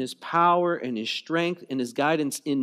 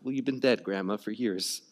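deadpan reply; 7 semitones lower, 5 dB quieter than usual. Retold or imitated. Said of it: "I'll be like".

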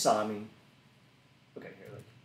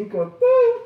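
higher, louder, slow sing-song peekaboo; mock peekaboo; 12 dB louder, slower than usual. Servant entertaining a child, bright sing-song peekaboo. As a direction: play peekaboo with a toddler.

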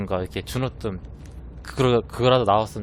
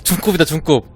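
ordinary delivery

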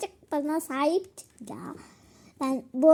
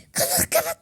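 panicked; 14 semitones higher, faster than usual. Pretend. Water spreading, panicked call.

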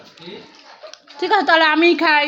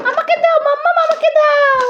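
put-on much higher, a small child begging for the balloon; toddler voice; 12 semitones higher than usual. Mother voicing her toddler asking for the balloon, high childlike plea. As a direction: plead like a little kid asking mama.

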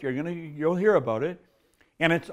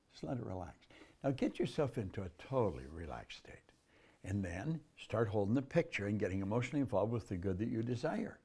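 soft, hushed relaxation guide; soft spa whisper; 4 semitones lower, 7 dB quieter than usual. Acted. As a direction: ease them into calm, near whisper. Leads a body relaxation exercise.

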